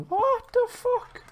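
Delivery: high-pitched squeal of disbelief; shocked, voice leaping very high. Real, not acted. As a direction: squeak it out, startled. Discovers stream is visible to everyone.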